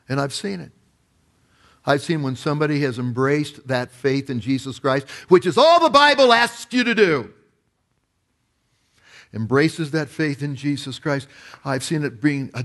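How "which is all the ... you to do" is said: sudden preaching shout. Acted. Sermon emphasis burst.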